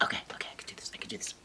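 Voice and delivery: very soft, whispery muttering; nervous self-pep-talk. Acted. Shy Sam psychs herself up, anxious whisper. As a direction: whisper hurried encouragement to yourself.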